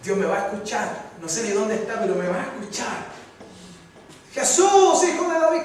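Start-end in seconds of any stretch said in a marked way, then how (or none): 4.3-5.6 calling out. Acted. Beggar calling out again.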